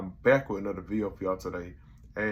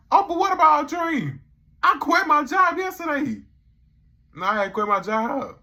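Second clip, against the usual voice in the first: panicked whine; 9 dB louder than usual. Retold or imitated. Dave wailing to Oprah about quitting his job, high. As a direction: wail it in comic despair. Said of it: "He's like".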